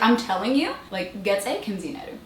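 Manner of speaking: ordinary talk, nothing marked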